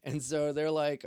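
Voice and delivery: ordinary talk, nothing marked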